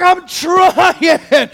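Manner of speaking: high, strained comic protest; exasperated protest. Acted. Player answering his impatient coach, exasperated protest. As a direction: plead it in mock desperation.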